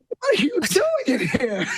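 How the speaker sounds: stunned, a laugh breaking through the question; retold shock, giggling mid-line. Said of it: "I'm in shock".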